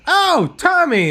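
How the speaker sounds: high, loud, slow drawn-out greeting; mock surprised greeting. Old acquaintance at U G A spotting him after weight gain, high drawn call. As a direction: stretch the name out high and loud. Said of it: "someone's like".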